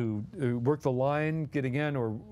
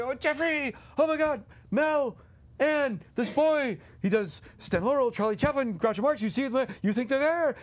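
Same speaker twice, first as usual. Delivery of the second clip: gushing excitement; 9 semitones higher than usual. Retold or imitated. Dom thrilled to see Jeffrey.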